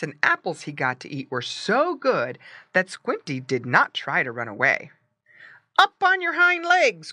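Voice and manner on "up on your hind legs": put-on high, loud boy's command; excited high-pitched order; crying out. A narrator's reading. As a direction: call it out high and loud to a pet. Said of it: "cried the boy".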